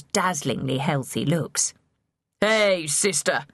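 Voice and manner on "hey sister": mild, slow hail; lightly acted brotherly call. A narrator's reading. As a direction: stretch it out like a call from below. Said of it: "he called".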